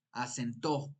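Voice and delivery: "acentó" stressed on the final syllable: a-cen-TÓ; stress on last syllable. Shown as a demonstration. Teacher testing where the tonic syllable falls, "acentó" stressed on TÓ. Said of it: "si dijéramos acentó".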